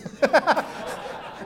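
high breathy laugh at his own joke; real laugh. Real, not acted. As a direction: laugh at the punchline.

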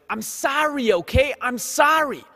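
put-on loud, high Bronx kid apologizing; a whiny Bronx kid shouting sorry; Bronx accent. Kid caught letting go, Bronx accent shout. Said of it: "the kid spoke with a Bronx accent".

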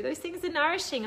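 ordinary talk

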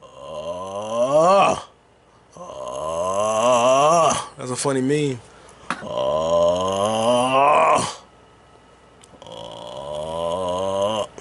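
deep drawn-out playful oh; lightly goofy low oh; low mock oh. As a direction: drop the voice low and stretch it.

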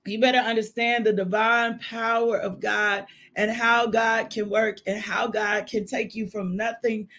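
ordinary talk, nothing marked

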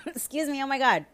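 strained, recovering from choking; choking on a drink. Real, not acted. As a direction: talk through a choke. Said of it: "I'm choking".

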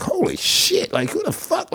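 breathy, high-pitched holy shit; amazed disbelief. Retold or imitated. Skater hears a movie star name-drop Stevie, amazed. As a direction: gasp it out in shock.

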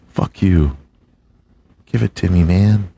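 ordinary talk

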